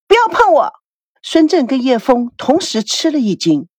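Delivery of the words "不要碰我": sharp high shout; yelling. A narrator's reading. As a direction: bark it out, pushing them away. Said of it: "孙娜吼起来". (女儿冲着父母吼叫)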